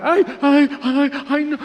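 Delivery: high-pitched mocking whimper; mock whining adult. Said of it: "cuando adultos hablan así, como si hiciéramos bullying". (quejido agudo de burla)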